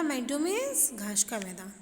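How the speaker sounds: normal talking voice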